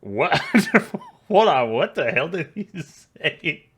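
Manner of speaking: loud, high, laughing disbelief; baffled laughter. Real, not acted. Texan reacting to an incomprehensible British clip, baffled laughter. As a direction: crack up in total bafflement.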